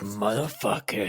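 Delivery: low, quiet mock-angry mutter; muttered curse. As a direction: curse under your breath, imitating him. Acted muttered curse of angry character.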